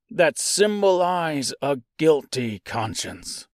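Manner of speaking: slow, measured reading of a quoted passage; formal read-aloud. Acted. Reading from Cliff's Notes to viewers, slow and deliberate.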